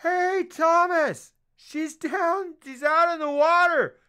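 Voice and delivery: high, loud, drawn-out shouts; hammed-up wailing call to a shipmate; mock distant hollering. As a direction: holler it slowly across the water. Acting out shouting to Thomas.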